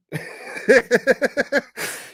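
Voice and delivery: high burst of laughter; loud amused laughter. Real, not acted. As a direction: crack up at the clip. Laughing at clip.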